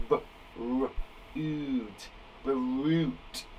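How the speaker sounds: B-r-oot said softly as separate sounds, then Brute; teacher modelling sounding out Brute; segmenting sounds. Shown as a demonstration. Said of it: "sound them out".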